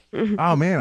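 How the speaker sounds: normal talking voice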